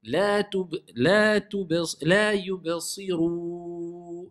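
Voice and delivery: chanted yubsiru ending on a long pure OU; restart, then hold yubsiru on "rouu"; slow recitation, unnasal yubsiru; clear OU. Shown as a demonstration. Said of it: "Là, c'est ou".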